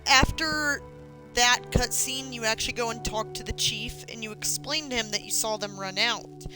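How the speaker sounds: normal talking voice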